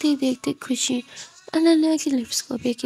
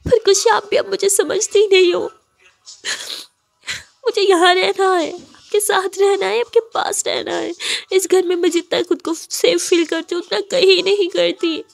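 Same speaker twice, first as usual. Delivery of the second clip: higher, louder tearful outburst; crying; 7 semitones higher, 5 dB louder than usual. Acted. Ananya cries to Khushi about home, sobbing. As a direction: plead through tears to be understood. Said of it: "ज़ोरों से रोने लगती है".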